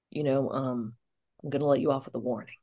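low, soft officer voice; lenient policeman. Retold or imitated. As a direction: imitate a cop quietly letting someone off. Policeman leniently letting her off.

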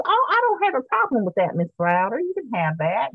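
a hint of an old Southern gentleman, amused; Southern twang. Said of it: "Deep Southern twang".

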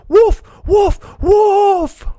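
loud, very high cry of alarm; boy's high-pitched alarm call; shouting. A narrator's reading. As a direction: shout high for help, stretching the last call. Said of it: "Then he started shouting".

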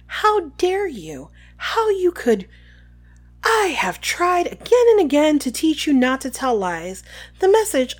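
high-pitched, indignant scolding; Umbridge. Acted. Voices Umbridge scolding Harry.

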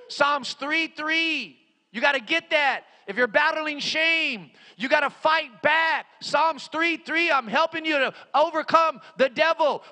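high, urgent pulpit exhortation; full-force preaching. Real, not acted. Preacher urges those battling shame, full-force preaching. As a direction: urge them to fight.

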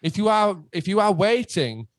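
high-pitched, exasperated telling-off; retold exasperation. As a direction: re-enact setting an insistent fan straight, pitched well up.

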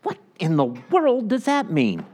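a high, baffled what, mock confusion; puzzled reader. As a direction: play the reader lost in the verse. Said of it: "you read Scripture and you go".

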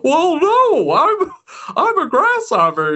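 Jiminy Cricket done as Jimmy Stewart. Retold or imitated. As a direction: stammer politely like Jimmy Stewart. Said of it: "straight up Jimmy Stewart voice".

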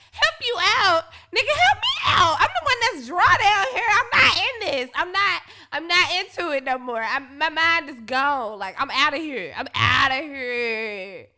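high, loud laughing rant; mock outrage. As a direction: rant at him, half laughing. Retold outburst at partner, laughing.

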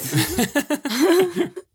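bright high-pitched laugh; high amused laugh. Real, not acted. Reacts to watching TV answer.

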